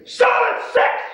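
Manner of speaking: loud, high pit-trader yell; confrontational shout. Retold or imitated. Trader imitating a confrontational colleague, shouting the call. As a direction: bellow the call across the pit.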